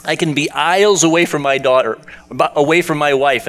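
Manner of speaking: ordinary talk, nothing marked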